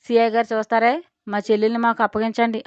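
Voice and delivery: ordinary delivery, nothing marked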